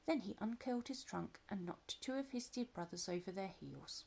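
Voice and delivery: ordinary delivery, nothing marked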